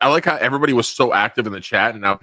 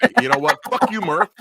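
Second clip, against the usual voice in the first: mock outburst; 13 semitones higher, 5 dB louder than usual. Mock calling out absent friend.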